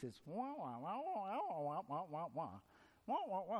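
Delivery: muffled trombone-like wah wah; Charlie Brown teacher wah-wah. Retold or imitated. Preacher mimics the cartoon teacher's garbled talk. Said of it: "whenever the teacher speaks".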